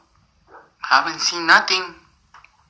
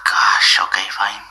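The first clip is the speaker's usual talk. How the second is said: sighing, whispered grudging okay; reluctant whisper; 10 semitones lower, slower than usual. Acted. Therapist grudgingly agrees to hear the problems, whispered sigh. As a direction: give in under your breath, annoyed.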